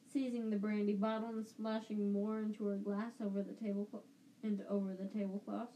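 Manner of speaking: usual speaking voice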